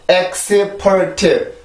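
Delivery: "엑스퍼트" slowly in four even syllables; Korean pronunciation. Shown as a demonstration. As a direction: count out "엑-스-퍼-트", each vowel sounded. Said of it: "사음절인데".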